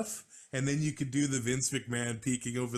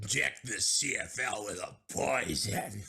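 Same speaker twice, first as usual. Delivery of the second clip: Vince McMahon. Acted. Co-host joining the bit about the CFL, in a Vince McMahon voice. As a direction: proclaim it like a scheming wrestling boss. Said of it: "use my Vince McMahon voice".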